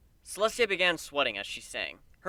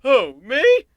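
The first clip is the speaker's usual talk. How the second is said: high, loud startled squeak; nervous; 11 semitones higher, 16 dB louder than usual. Acted. The prince caught in the spotlight, high nervous yelp. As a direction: yelp it in sudden panic. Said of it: "the suddenly very nervous prince asks".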